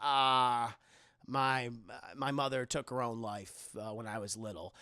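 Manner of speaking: low, soft, hesitant; quiet and hurting, fading away; low quiet fading confession; sad confession. Retold or imitated. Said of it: "She's like".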